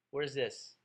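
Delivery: normal talking voice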